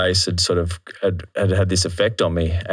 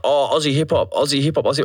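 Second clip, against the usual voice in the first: higher, repetitive mock chant; mocking Aussie scene; 5 semitones higher than usual. Ostracized rapper imitating the local hip hop community, mocking. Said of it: "'cause they were like".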